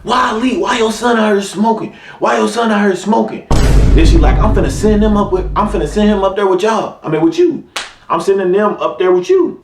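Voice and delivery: imitating mom on phone. Mom complaining to dad on phone.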